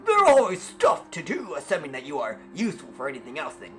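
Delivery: loud, laughing taunt at a robbed stranger; loud laughing mockery; mocking NPC. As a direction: jeer and cackle as a smug townsman. Shopkeeper NPC mocks the player.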